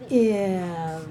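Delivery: word ending stretched long; sounding out. Shown as a demonstration. Teacher sounds out the rest of give, slowly stretched. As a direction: draw out the vowel and final consonant.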